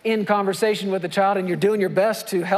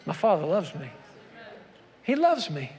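quiet affirmation; 5 semitones lower, 5 dB quieter than usual. Real, not acted. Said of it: "tender quiet affirmation".

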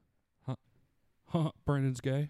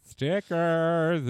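higher, put-on nanny voice; Missus Doubtfire; 3 semitones higher, 4 dB louder than usual. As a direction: mimic the film nanny, drawn out. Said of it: "I was doing a Missus Doubtfire impression".

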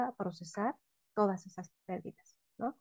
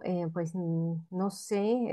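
mock hesitant stalling; much slower than usual. Imitating hesitant psychologists.

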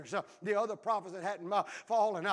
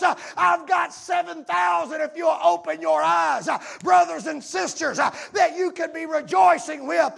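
high, loud preaching shout, slowing at the peaks; full-force preaching; 7 semitones higher, 11 dB louder than usual. Acted. Pastor preaching to the congregation, full-force shout. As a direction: preach it out to the back row.

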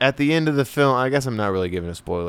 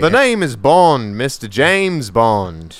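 louder, slow mock Southern Bond line; British Southern Bond; 4 semitones higher, 4 dB louder, slower than usual. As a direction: say Bond's line in a hammed-up Southern drawl. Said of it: "You're doing, like, a British Southern".

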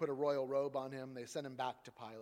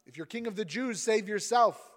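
higher, louder taunt; mocking call; 7 semitones higher, 11 dB louder than usual. Soldiers jeering at Jesus, loud mocking. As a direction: jeer up at him. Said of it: "Soldiers mocked him".

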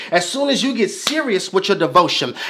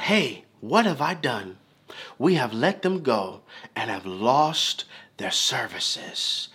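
lightly voiced Pharaoh, soft, low and slow; a hint of a regretful king, murmured; hushed Pharaoh; 7 semitones lower, 8 dB quieter than usual. Preacher voicing Pharaoh regretting freeing slaves, hushed.

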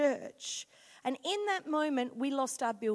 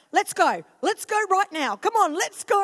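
loud impatient call, lots higher; yelling out; 7 semitones higher, 11 dB louder than usual. Retold or imitated. Husband yelling at the family to leave, loud and high. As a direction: yell to hurry everyone out. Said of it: "he'll yell out".

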